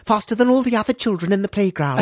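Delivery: very high, put-on little child voice; idealised child. Imagined son telling Daddy his wish, high earnest child voice. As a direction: pipe up like an earnest small child. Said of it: "hope that maybe your children will wish for".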